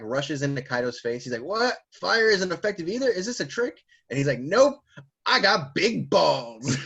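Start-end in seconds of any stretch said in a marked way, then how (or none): 1.4-3.8 high-pitched mock bewilderment; astonished disbelief. Recapper as Kaido seeing fire fail, mock astonished. 4.4-6.8 high, loud, drawn-out brag; cocky boast. Retold or imitated. Recapper as Luffy answering Kaido, hammed-up bragging.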